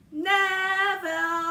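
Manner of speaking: huge, high, long-drawn holler; very loud, stretched-out yell; group shout. A narrator's reading. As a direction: belt the name out like a whole chorus. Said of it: "They all shouted together".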